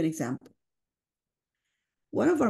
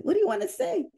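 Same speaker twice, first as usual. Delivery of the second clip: voicing herself; 13 semitones higher, faster than usual. Her own reply in the story.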